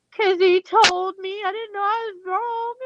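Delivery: high, drawn-out mock-tearful wail; retold bawling. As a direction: whine it slowly and high, as if bawling. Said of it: "I'm sitting there bawling".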